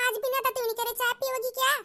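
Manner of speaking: usual speaking voice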